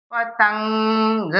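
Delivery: पतंग said very slowly, the final ng held in the nose; nasal ending drawn out. Shown as a demonstration. Teacher showing the nasal dot to students, slowly. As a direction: stretch पतंग, let the ng ring nasally. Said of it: "अंग की बिंदी".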